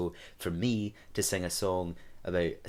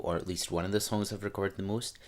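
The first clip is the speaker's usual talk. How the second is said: usual speaking voice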